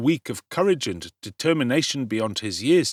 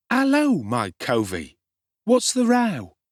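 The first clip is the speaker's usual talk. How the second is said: much higher and louder, brash urchin hail; cocky street boy; 10 semitones higher, 4 dB louder than usual. A narrator's reading. Street boy greeting hungry Oliver, loud and cocky. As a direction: sing it out with swagger. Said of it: "said this strange young gentleman to Oliver".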